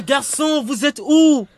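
loud, high calling out. Acted. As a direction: call across the bushes. Calling for the hidden boys. (appel à voix forte)

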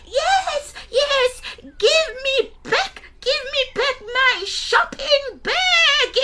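put-on very high, slow frantic old woman; old granny voice. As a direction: shriek it like a desperate granny. Gogo voiced in story.

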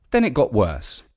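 usual speaking voice

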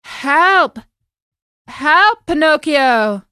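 high loud desperate calls; panicked cry for help. Acted. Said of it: "He turned to Pinocchio and said".

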